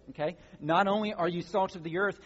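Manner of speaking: normal talking voice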